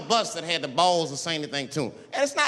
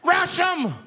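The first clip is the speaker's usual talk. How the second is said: screamed rallying cry; 2 semitones higher, 3 dB louder than usual. Acted. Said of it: "He screamed out".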